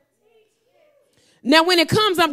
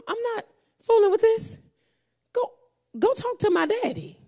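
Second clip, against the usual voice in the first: soft, tearful; 5 semitones higher, 5 dB quieter than usual. Retold or imitated. Retold words after crying.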